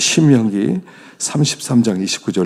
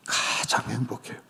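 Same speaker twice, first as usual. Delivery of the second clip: whispered emphatic line; 11 dB quieter than usual. Real, not acted. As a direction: whisper it to make it land.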